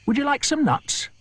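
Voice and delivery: chirpy monkey. Acted. Mugglewump greeting the crocodile, high and chirpy. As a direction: squeak it out like a monkey.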